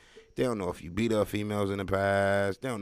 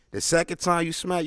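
ordinary delivery

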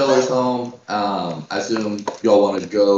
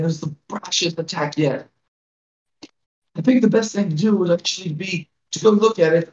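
put-on frail old village elder, worried; a hint of a tiny old lady pleading; very old woman; 8 semitones higher than usual. GM voicing elder Grilda.

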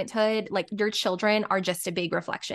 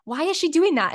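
exasperated, higher and louder; frustrated; 7 semitones higher, 5 dB louder than usual. Retold or imitated. Mother wondering about her daughter's yelling, frustrated. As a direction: imitate a fed-up parent's baffled question. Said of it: "I'm always so frustrated".

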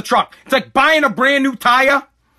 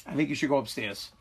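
gentle, upset parent; 7 semitones lower, 7 dB quieter than usual. Real, not acted. Upset dad sending child upstairs, hushed and gentle. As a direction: hold back the anger, speak softly and low.

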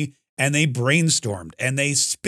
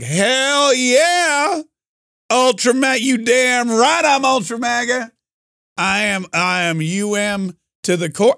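high, whooping fan boast; drawn-out, hammed-up hell-yeah swagger; rowdy mock pride; 8 semitones higher, 3 dB louder, slower than usual. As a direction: imitate a fired-up crowd owning it. Crowd proudly embracing the insult.

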